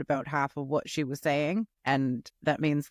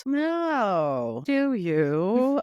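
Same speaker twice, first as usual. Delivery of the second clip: mock surprise; 6 semitones higher, much slower than usual. Real, not acted. Teasing cohost's sly remark.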